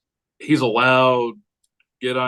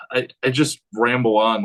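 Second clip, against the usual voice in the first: usual speaking voice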